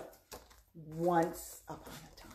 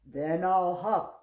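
loud threat climbing to a stretched howl; big wolf voice; 12 semitones higher, 14 dB louder than usual. Acted. Wolf threatening the straw house, loud building roar. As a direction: build from huff to a huge blow. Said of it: "low wolf voice".

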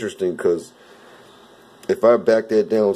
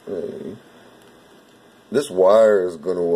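ordinary talk, nothing marked

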